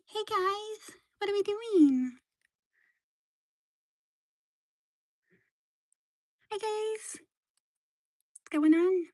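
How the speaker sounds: very high squeaky pet voice. Pretend. Voicing pet's thoughts.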